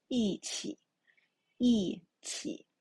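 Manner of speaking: 一起 as yìqǐ, 一 falling; teacher demonstrating 一起; fourth tone yi. Shown as a demonstration. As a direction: model 一起 with a falling 一. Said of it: "E, the fourth tone".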